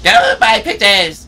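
drawn-out, slow hammed-up witch; half-hearted wicked witch voice, slow; mock witch cackle line. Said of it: "You have to say that in a Wish's accent".

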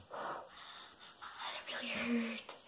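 slow hurting whisper; pained whisper. Real, not acted. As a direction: whisper it slowly through pain. Whisper after falling.

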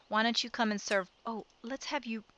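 normal talking voice

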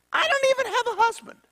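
very high, fast put-on girl voice; flustered Mary. Mary answering the angel, high and flustered. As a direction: squeak it out in disbelief. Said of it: "And Mary said".

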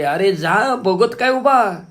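high, loud urgent yell, last words slow; loud shout. Retold or imitated. Mother shouting again at son to leave, desperate yell. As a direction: shout him out the door, desperate. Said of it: "मी पुन्हा जोरात ओरडले".

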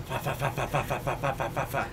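soft, rapid helicopter chop; imitated helicopter sounds. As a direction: imitate rotor blades with fa-fa-fa. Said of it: "That's helicopter sounds".

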